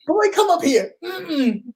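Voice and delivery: a mock shout across the funeral home; dad calling out, then refusal. Dad calling his son up to the casket, then the boy refusing.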